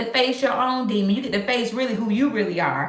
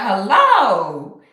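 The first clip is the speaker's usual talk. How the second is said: slow, drawn-out wondering hello; impressed double take; 6 dB louder, much slower than usual. Pretend. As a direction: act stunned as they walk in. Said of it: "they be like".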